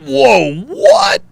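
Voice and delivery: high, loud mock astonishment; shocked dad. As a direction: imitate a dad's stunned outburst. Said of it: "And Dad said".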